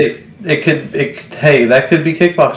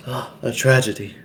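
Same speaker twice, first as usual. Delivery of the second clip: a light, quiet imitation of a thick French accent; a soft, understated put-on French accent on tragedy; 7 dB quieter than usual. Quoting Van Damme's line with a French accent. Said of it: "in his very thick French accent".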